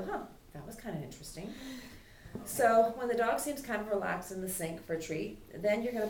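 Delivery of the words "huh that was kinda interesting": a soft, quick, curious dog voice; dog's inner voice. Retold or imitated. Trainer voicing the dog in the sink, softer and quicker. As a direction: lightly voice the dog as it sniffs the sink. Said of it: "So they go".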